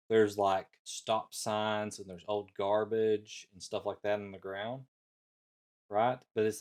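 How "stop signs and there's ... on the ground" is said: normal talking voice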